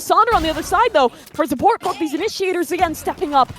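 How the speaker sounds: fast, rising play-by-play call; esports caster. Acted. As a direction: build the hype through the round. Live play-by-play casting.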